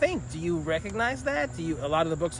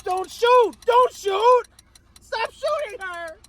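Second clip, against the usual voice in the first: high, loud mock panic; hammed-up horror-scene screams; acted terrified pleading; 8 dB louder, much slower than usual. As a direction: beg them not to shoot, very high. Acting a shooting scene, pleading.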